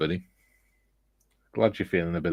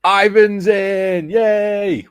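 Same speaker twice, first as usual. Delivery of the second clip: high, loud cheer, stretched out; excited cheer; 13 semitones higher, 8 dB louder, much slower than usual. Real, not acted. Host welcoming a returning regular to the stream, excited cheer. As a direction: call it out long and high with delight.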